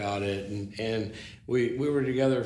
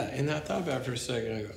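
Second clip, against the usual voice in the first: ordinary talk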